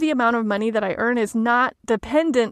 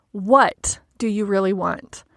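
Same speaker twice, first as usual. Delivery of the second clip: What hit hard and set apart, the rest lower; stress on What; 4 semitones lower than usual. Shown as a demonstration. Coach moving the emphasis around to see what comes up, stress on What. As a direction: lean on What, then let the rest fall away. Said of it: "putting the emphasis on what".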